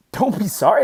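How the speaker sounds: retold insistent comforting of a child; emphatic reassurance. Dad consoling his tearful daughter after the race, emphatic reassurance.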